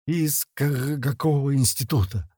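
stuttered, halting frightened question; stammering with fear. A narrator's reading. Captive answering his interrogators on the ground, stammering with fear. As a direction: stumble on the first sound, afraid. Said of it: "заикаясь от страха".

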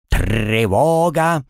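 slow drawn-out squawk; parrot squawk. Acted. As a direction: stretch it out like a parrot. Parrot pet cries alarm.

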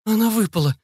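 high, shocked gasp of words; high-pitched appalled cry; horrified. A narrator's reading. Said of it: "ужаснулась Екатерина".

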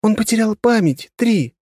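high squeaky little voice. A narrator's reading. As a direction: squeak it out from the corner. Kona continues.

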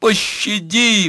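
very high, drawn-out cry; desperate high-pitched plea; full-throated wail. A narrator's reading. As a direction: scream for mercy in a high voice. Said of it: "вопила она во всё горло".

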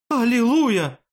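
very high, loud cry of joy; jubilant exclamation. A narrator's reading. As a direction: shout it high like a rescued man. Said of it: "воскликнул бедолага". (ликующий возглас, высоко и громко)

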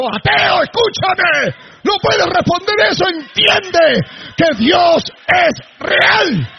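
shouted high and loud, slowing into a crying roar; preaching shout. Real, not acted. Preacher confronting an atheist, crying shout. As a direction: hammer it home at full force, voice breaking.

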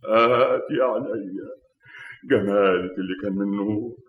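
weeping dirge over a lost love; tearful lament. Acted. Killer weeping over his dead beloved, tearful lament. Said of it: "أبكي عليك بدمع عينيا".

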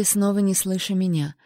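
plain reading voice

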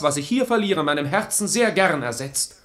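normal talking voice

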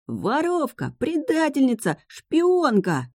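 высокий протяжный вой; воет от бессилия и обиды; вой раненого волка. A narrator's reading. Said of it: "завыл раненым волком". (вой, как у раненого волка)

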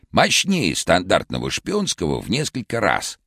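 very high, hoarse cartoon shout; vintage cartoon voice. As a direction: shout it like an old cartoon Karlsson. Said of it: "хриплым, как в старом мультике".

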